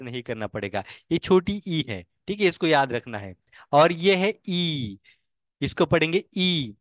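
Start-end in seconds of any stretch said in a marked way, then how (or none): 3.7-5.0 ई stretched into a long, slow ee sound; drawn-out long ee. Shown as a demonstration. Teacher drawing out ई for students learning vowels. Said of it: "बहुत जल्दी खत्म हो जाता है".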